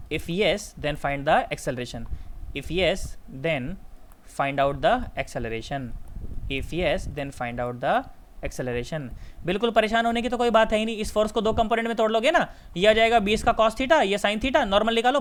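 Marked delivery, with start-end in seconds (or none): 0.1-9.1 low, slow phrases with pauses; slow dictation. Real, not acted. Teacher dictating the question's second part, low and slow. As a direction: let each chunk land for note-takers.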